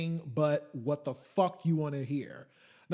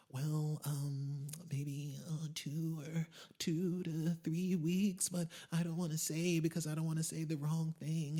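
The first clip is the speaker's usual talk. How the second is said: mock unsure rep; 6 dB quieter, slower than usual.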